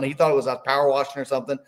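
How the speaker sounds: ordinary delivery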